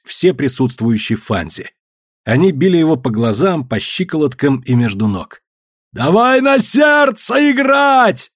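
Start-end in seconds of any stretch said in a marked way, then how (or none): 5.9-8.3 slow, very high scream; bellowing. A narrator's reading. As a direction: yell a desperate challenge, drawn out. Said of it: "орал Синдан".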